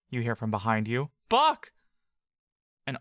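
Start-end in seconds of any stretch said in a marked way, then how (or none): 1.3-1.7 much higher, louder cry of a name; urgent shout. Acted. Voice behind Buck shouts his name again, urgent. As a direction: yell it desperately now. The call repeats, more urgent.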